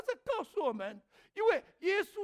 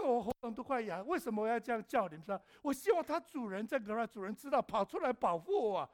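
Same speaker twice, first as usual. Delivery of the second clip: ordinary talk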